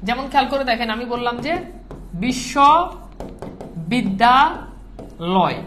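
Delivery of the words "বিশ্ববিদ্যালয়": বিশ্ববিদ্যালয় very slow, each piece stretched long; drawn-out syllables. Shown as a demonstration. Teacher sounding out vowels for the class, বিশ্ববিদ্যালয় stretched syllable by syllable. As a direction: hold each syllable of বিশ্ববিদ্যালয় and pause before the next. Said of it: "ভেঙে ভেঙে".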